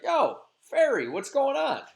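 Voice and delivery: high, loud, swaggering street greeting; cocky Robin. Retold or imitated. Robin Goodfellow hails a fairy he meets in the woods, high and brash. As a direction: hail the fairy like a buddy across the woods.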